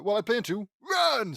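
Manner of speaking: high, loud line bursting on "run"; yelled getaway. A narrator's reading. Brother answers his sisters as he flees, yelling the last word. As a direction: build to a shouted "run!".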